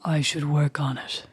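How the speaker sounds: mock-seductive deep murmur; creepy sexy voice. Shown as a demonstration. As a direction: sink into a slow, sultry low register. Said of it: "talking in that creepy voice".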